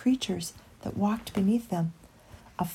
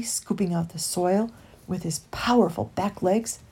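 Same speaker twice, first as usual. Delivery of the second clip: ordinary delivery, nothing marked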